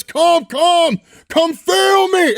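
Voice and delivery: high, slow put-on villain voice; mock Doctor Doom. The fan voicing Doctor Doom taunting Wolverine, high and slow. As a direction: ham up a lofty villain, way up high. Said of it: "Doctor Doom says".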